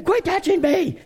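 put-on very high-pitched squabbling child voice; squeaky mock tattling and complaining; arguing kids, imitated. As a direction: mimic siblings bickering in the back seat. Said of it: "the kids started arguing".

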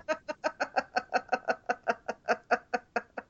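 high, breathy giggle; high-pitched giggle. Real, not acted. Dan laughs at confusion.

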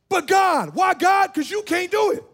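rising preacher's cry of but God; full-force preaching. Acted. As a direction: lift it up and drive it home.

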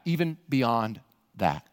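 ordinary delivery

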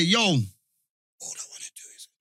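unvoiced whisper, slowed down; whispered quote. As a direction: breathe it out in a whisper. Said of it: "they say".

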